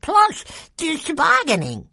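put-on high kid voice through a bite of pizza; excited little boy talking while eating; mouth full. A narrator's reading. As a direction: squeeze the words out round food. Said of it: "managed to say as he took the last bite".

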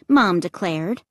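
ordinary delivery

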